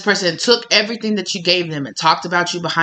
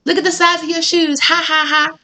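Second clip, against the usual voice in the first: high mocking ha-ha taunt; 10 semitones higher than usual. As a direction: jeer like a schoolyard bully. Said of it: "all fun and games".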